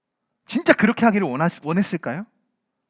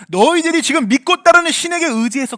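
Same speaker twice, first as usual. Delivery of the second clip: loud, raised rebuke as God; angry God; 8 semitones higher, 6 dB louder than usual. Acted. Preacher voices God refusing to save Israel, angry. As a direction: push it out as a forceful dismissal. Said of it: "많이 화가 나셨을까요".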